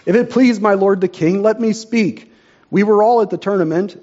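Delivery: a courtier speaking up loudly before the king; loud call. Acted. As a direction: call out boldly to the crowd. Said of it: "in a loud voice called out".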